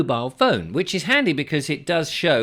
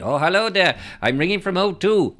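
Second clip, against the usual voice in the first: put-on Indian accent; 6 semitones higher, 3 dB louder than usual. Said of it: "as soon as you get the Indian accent".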